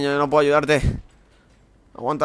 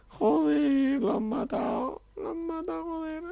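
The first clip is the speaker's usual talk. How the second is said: mock crying; 6 dB quieter, much slower than usual. Friend killed again.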